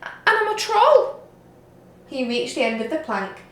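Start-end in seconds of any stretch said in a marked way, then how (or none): 0.0-1.2 higher, timid little voice; very small voice. A narrator's reading. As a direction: protest meekly, shrinking. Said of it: "said the Troll in a very small voice".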